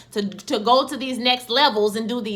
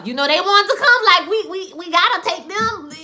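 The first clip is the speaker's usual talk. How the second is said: high, louder, whiny resignation; a lightly voiced Montrose giving in about the car; exasperated Montrose; 7 semitones higher, 5 dB louder than usual. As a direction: sigh it out like a beaten-down dad. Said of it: "Montrose is looking like".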